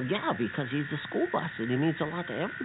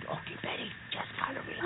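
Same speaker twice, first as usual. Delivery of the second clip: whispered to a nervous friend; sneaking through the woods, whispering to Betty; 9 semitones higher, 11 dB quieter than usual. Acted. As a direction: breathe it out unvoiced. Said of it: "we gotta be real quiet now".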